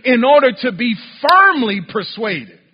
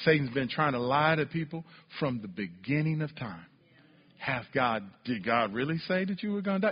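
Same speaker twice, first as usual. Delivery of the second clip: much softer and lower sermon voice; quiet preaching; 10 semitones lower, 13 dB quieter than usual. Acted. To the congregation, hushed low preaching about Eve. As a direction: bring it down to a hush.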